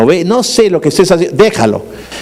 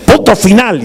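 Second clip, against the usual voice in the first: mother's mock-stern last word, slightly raised; stern through the teeth; 3 semitones higher than usual. Mother ending the argument with her child, stern through teeth. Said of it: "y los dientes".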